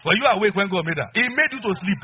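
loud, high rhetorical challenge; loud preaching; preaching shout. Real, not acted. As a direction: thunder it at the men.

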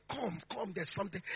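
a sick mother gently calling her son back; mother calling softly. Retold or imitated. Mother, ill in bed, calls her son back: soft hushed voice. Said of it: "She said".

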